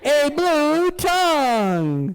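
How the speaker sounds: BLUE TONGUE stretched way out, pitched high; dramatic reveal. Acted. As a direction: draw out "blue tongue" like a grand showman's reveal.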